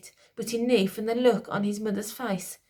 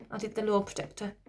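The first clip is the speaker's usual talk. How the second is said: ordinary delivery, nothing marked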